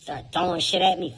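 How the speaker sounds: ordinary delivery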